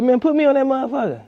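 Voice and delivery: high, fast re-enacted plea; excited demand. Asking creators to put him on, acted excited demand. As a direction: push it out high and quick. Acts out his eager reply.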